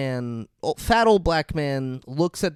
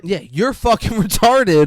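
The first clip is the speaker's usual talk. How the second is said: slow, high, loud jeer through a laugh; mock scolding; 7 semitones higher, 8 dB louder than usual. Co-host joining the rant at the audience, loud laughing mockery. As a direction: pile on loudly, stretching the insult.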